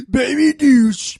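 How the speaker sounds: loud, slow, high taunt; silly taunt. Acted. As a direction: drag it out like a playground taunt.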